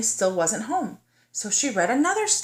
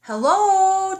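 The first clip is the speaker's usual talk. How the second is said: high, loud call; loud searching hello; 10 semitones higher, 8 dB louder than usual. Acted. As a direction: call out into the woods. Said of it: "Tickles called".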